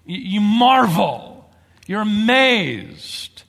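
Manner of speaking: slow, loud, drawn-out amazement; amazed. Shown as a demonstration. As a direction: let the wonder stretch out. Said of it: "reaction of astonishment".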